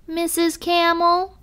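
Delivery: high-pitched, slightly timid calling out; mild trembling call. A narrator's reading. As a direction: call up high with a touch of nerves. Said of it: "with my trembling voice".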